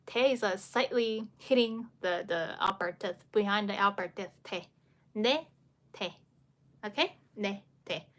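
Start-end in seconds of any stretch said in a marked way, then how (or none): 5.2-6.1 nasal Ne then plain te; 先发鼻音“Ne”再发平常的“te”; ne versus te. Shown as a demonstration. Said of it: "Nasal sound".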